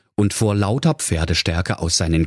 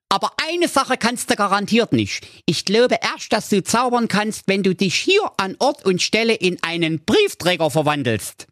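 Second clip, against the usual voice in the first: Doktor Schulz; 12 semitones higher than usual. Acted. Dog keeps goading wizard.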